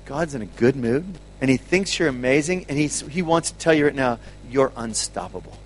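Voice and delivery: lightly voiced tender encouragement; gentle encouragement. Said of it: "and I said".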